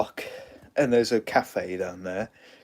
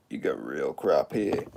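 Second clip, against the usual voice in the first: lower, a touch gruff; lightly voiced DJ; 6 semitones lower than usual. DJ mocking the cattail corn dog, lower voice. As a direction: drop the voice for a friend's dry remark. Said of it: "D J's like".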